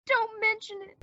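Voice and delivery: usual speaking voice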